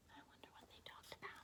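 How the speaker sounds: very soft fast whisper; whispered aside. Real, not acted. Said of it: "whispered aside".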